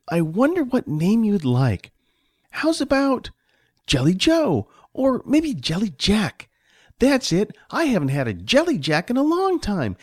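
put-on high, excited child voice; playful little boy. Johnny names his new friend, excited child voice. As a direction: think aloud like a delighted small boy.

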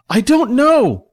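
high, frantic answer; panicked. A narrator's reading. Billy answers Celine amid the fight, panicked.

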